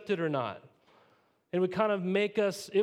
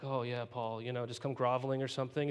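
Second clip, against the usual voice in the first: mock groveling; 5 semitones lower, 4 dB quieter than usual. The church coming back to Paul, mock groveling. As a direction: lower and softer groveling. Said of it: "be like".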